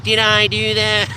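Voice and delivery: slow, nasal-free squeaky Urkel line; Steve Urkel impression. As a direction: deliver it like the sitcom nerd catchphrase. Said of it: "Steve Urkel-like".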